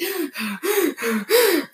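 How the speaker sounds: exaggerated heavy panting gasps; hard breathing. Student panicking in the lunch line, breathing hard. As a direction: gasp for air as if freaking out. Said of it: "I was breathing so hard".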